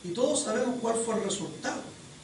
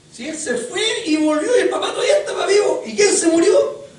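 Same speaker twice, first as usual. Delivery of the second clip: laughing; 10 semitones higher, 10 dB louder than usual. Real, not acted. Preacher laughing at the irony.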